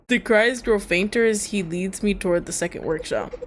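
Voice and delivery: ordinary delivery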